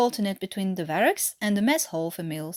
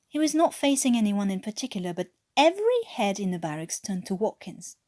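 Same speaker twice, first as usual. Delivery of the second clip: ordinary delivery, nothing marked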